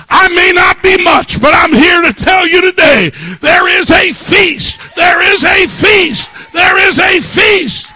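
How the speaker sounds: high, shouted sermon climax; impassioned preacher at full volume; full-force preaching. Real, not acted.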